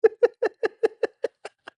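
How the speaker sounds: loud high laugh. Real, not acted. Streamer cracking up at the bot's answer, high laugh. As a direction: burst of high shrieking laughter.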